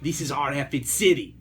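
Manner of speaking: put-on voice of a famous slugger; David Ortiz. Podcast host quoting a slugger's speech to Boston, in impression. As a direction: impersonate the ballplayer's rallying quote. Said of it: "That's my David Ortiz impression".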